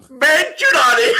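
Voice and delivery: high, loud mock French cry, drawn out; mimicking shout. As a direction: belt it out slowly in parody, close to laughter. Host loudly mimics co-host's French attempt.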